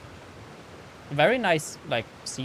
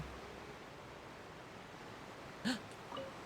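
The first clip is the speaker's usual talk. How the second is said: delighted gasp; 9 semitones higher, 19 dB quieter than usual. Real, not acted. Spots something new in game.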